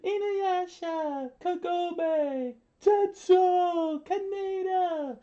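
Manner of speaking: high, drawn-out mock cries; hammed-up anime scream. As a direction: parody anime heroes screaming names. Joking parody of anime name screams.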